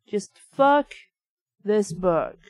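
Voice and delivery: plain reading voice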